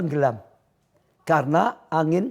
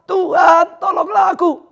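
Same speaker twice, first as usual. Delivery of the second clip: crying for help; 12 semitones higher, 4 dB louder than usual. Shown as a demonstration. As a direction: cry out to the Lord like Peter. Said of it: "Ayo teriak saudara". (berteriak minta tolong)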